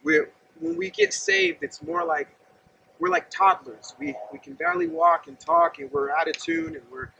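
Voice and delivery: ordinary talk, nothing marked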